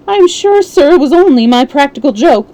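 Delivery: cringing excuse; whimpering. A narrator's reading. Oldacre pleads with the inspector, whimpering. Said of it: "The wretched creature began to whimper".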